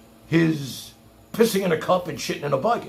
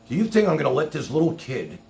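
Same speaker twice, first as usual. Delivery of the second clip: ordinary talk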